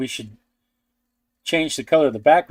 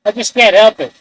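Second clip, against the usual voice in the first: higher and louder over the dryer noise; talking over a hair dryer; 6 semitones higher, 5 dB louder than usual. Real, not acted. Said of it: "I can't help myself trying to talk over the hairdryer".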